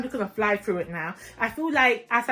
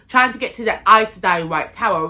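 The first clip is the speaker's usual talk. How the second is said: normal talking voice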